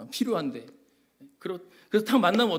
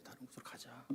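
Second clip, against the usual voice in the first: whisper; 4 semitones lower, 26 dB quieter than usual. Acted. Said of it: "repeated softly".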